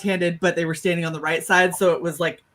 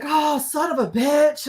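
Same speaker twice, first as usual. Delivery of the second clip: drawn-out, higher-pitched pained curse; slow retold yelp of pain; pained cursing; 7 semitones higher, slower than usual. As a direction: curse while clutching a sore head. Reacting to blow on head.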